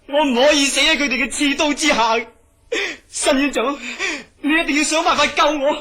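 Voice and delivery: loud, high, breaking with fear; panicked pleading. Acted. Terrified patient begging the doctor to save him, panicked. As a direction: beg for your life, near tears.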